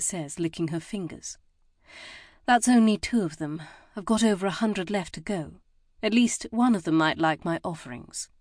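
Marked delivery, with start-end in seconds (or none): none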